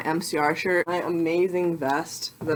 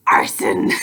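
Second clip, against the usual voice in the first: low hissed goblin whisper; 10 semitones lower, 5 dB louder than usual. Pretend. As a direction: hiss it like a mischievous goblin. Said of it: "I'll be like".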